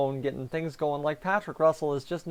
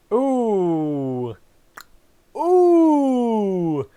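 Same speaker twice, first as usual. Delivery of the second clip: pained ooh; 6 semitones higher, 7 dB louder than usual. Real, not acted. Groaning at a goalie error.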